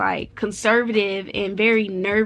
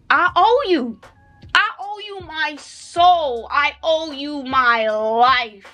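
raised, slow, stretched-out declaration; fervent gratitude; 5 semitones higher, 3 dB louder, slower than usual. Real, not acted. Blessed mom declaring her debt to the Lord, fervent. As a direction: proclaim it with real devotion, drawing out the key words. Fervent thanks addressed to God.

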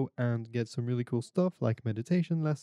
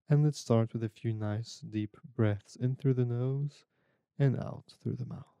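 guided meditation. Acted.

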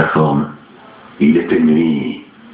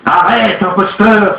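high, louder furious cry; angry cry; 12 semitones higher, 3 dB louder, slower than usual. A narrator's reading. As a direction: shout him down. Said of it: "s'écria Madame Olcott".

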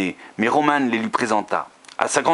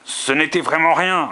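indifferent tone; 7 semitones higher than usual. A narrator's reading. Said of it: "d'un ton indifférent".

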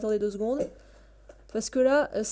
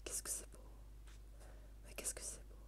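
barely audible, admiring whisper; awed whisper; 29 dB quieter than usual. Real, not acted. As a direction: whisper it in wonder. Hushed awe at the game's beauty. (chuchotement émerveillé)

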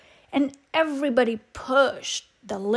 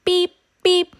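cheerful truck beeps; 7 semitones higher, 4 dB louder, slower than usual. A narrator's reading. Happy truck beeping at end.

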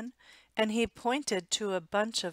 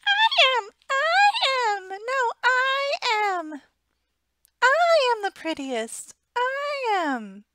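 high, loud squabbling cat voices; every cat yelling to be picked, squeaky; cats crying out; 10 dB louder than usual. Acted. Cats squabbling over who is prettiest, high and loud. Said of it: "cried hundreds and thousands ... of voices".

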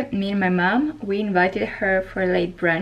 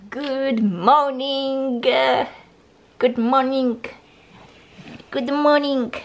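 slow, high, cooing morning greeting; sing-song wake-up; 4 semitones higher, much slower than usual. Acted. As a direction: coo it slowly to wake a sleepy dog. Waking the sleeping dog.

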